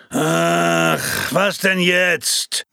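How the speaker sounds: slow, exasperated groan of words; a fed-up sigh at the phone ringing; annoyed outburst. Acted. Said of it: "rief der daraufhin genervt aus".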